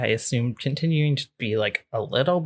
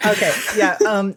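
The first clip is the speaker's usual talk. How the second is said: usual speaking voice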